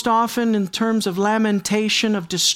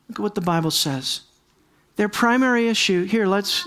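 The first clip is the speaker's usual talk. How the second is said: usual speaking voice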